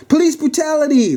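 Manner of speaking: high-pitched outraged cry; frantic high shout to onlookers. Acted. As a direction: cry out in protest, voice way up. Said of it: "the lady shouted".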